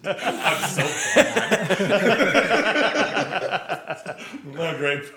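giggling through the words; laughing. Real, not acted. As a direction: laugh out the admission. Laughing at own joke.

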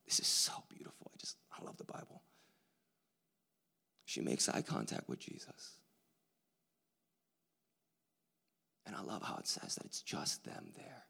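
hushed, awed whisper. Real, not acted. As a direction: breathe it like a secret in awe. Awed aside in the middle of the sermon.

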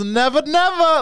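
high, slow Brooklyn customer; Brooklyn accent. Retold or imitated. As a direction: echo it in a Brooklyn drawl. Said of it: "the customer goes".

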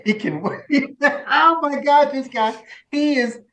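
high, laughing voice; words breaking up with real laughter. Real, not acted. Coach remembering a funny client, laughing. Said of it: "he just makes me laugh".

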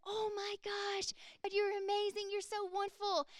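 high, awed rush of praise; amazed gasp. Retold or imitated. Young believers marvel at the healing, awed high voice. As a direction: gush like a stunned new believer.

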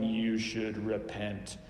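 slow soapbox preacher voice; preachy scold. Retold or imitated. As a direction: wag a finger, slow and preachy. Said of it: "picture Jesus on a soapbox".

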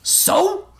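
high, quick retort; challenging high comeback; shot back. A narrator's reading. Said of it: "Tiger shot back".

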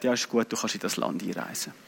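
low, very soft offhand permission; understated, deep-pitched official's okay; officer letting through. Retold or imitated. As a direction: mumble the clearance low and quiet. Officer waves him through.

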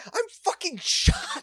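high, slow, stunned; stunned squeal after getting shot; quoting a shot actor. Retold or imitated. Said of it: "He's like, basically like".

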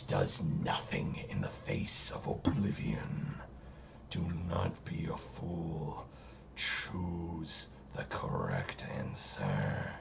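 low, sinister eldritch whisper; whispering entity. Acted. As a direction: hiss it like an ancient evil.

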